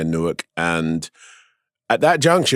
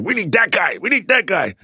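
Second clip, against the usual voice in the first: higher, eager repeated push; eager insistence; 10 semitones higher than usual. Retold or imitated. John Krasinski pushing for the guest, eager. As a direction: sell it like a fan who wants him. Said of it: "He was like".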